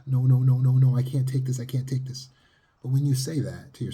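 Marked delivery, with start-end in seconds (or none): 0.1-2.3 high, loud frantic no no no; panicked protest. Shown as a demonstration. Therapist acting a panicked client cowering in fear. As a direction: panic and push the pain away. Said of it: "cowering in fear of the emotional pain".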